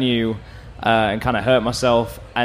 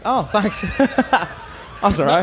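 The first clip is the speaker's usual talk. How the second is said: laughing; 8 semitones higher, much slower than usual. Real, not acted. Guest flattered, laughing.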